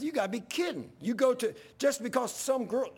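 high-pitched, exasperated protest; worked-up, incredulous rebuke; retold yelling. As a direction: re-enact arguing hotly down the phone. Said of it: "I'm yelling in the phone".

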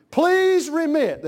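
loud, high, drawn-out please; hammed-up payment request; mock bill demand. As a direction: stretch the polite word into a mock plea. Mimics a phone company's bill.

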